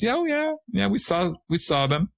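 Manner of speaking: high, offhand imitation of the friends; casual friends. Friends casually admitting they saw the strangers, high and offhand. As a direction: shrug it off in a high breezy voice. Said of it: "they were just all casual".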